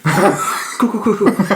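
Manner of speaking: high, tittering fake composure; mock nervous cover-up. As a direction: imitate someone badly hiding panic. Retold nervous reaction to summons.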